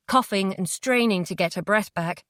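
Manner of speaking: plain reading voice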